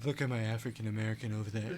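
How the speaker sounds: fast, boastful Trump voice; Trump impression. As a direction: brag like Trump. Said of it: "That was my Trump impression".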